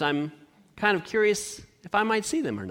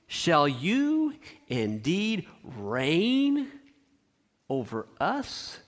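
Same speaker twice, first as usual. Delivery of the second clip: scornful brothers; 3 semitones lower, 5 dB quieter, much slower than usual. Acted. Brothers' mocking retort read.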